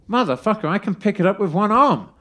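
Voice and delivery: exploding at the swindle; furious outburst. Retold or imitated. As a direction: blow up as the tank lifts with one arm. Discovers the empty tank.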